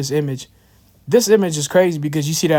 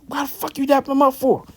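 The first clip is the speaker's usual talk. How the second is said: much higher, imitating the girlfriend yelling; retold girlfriend snapping at her man; mock angry woman yell; 10 semitones higher than usual. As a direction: pitch way up as the furious girlfriend. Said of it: "She yelled at him".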